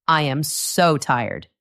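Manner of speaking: "so" drawn out and weighted, slow; stress on so. Shown as a demonstration. Answering a friend who asks if you're tired, stressing so. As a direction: stretch the word "so" to sound really worn out. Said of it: "By emphasizing "so".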